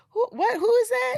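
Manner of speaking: high startled who is that; loud high squeal of surprise; surprised. Retold or imitated. Cast at wrap party, squealing in surprise.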